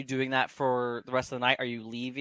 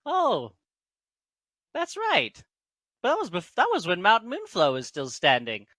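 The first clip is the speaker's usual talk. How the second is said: high-pitched voice of sudden recollection; halflings remembering; 8 semitones higher, 5 dB louder than usual. Acted. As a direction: start high on the realisation, then settle a little. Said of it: "then they're like".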